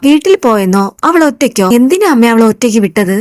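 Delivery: plain reading voice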